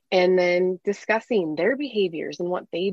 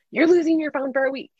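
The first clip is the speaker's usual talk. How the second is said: higher, louder, a stern parent's threat; mock angry threat; 9 semitones higher, 4 dB louder than usual. Frustrated mom threatening her sassing kids with a louder, higher mock threat. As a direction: snap out the punishment, a bit hammed-up.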